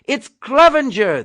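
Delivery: men in the jeep shouting up at Clevenger, loud. Acted. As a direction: shout in relief. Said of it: "they shouted".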